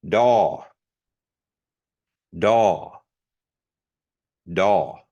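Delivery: Daw with a plain voiced d; Kiowa D. Shown as a demonstration. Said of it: "hearing the different Ds".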